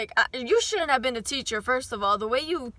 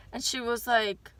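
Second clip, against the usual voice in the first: ordinary delivery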